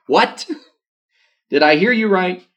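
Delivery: stunned disbelief. Acted. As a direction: act taken aback, as if doubting your ears. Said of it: "like, stunned".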